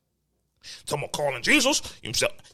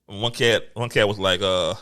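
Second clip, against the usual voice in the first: normal talking voice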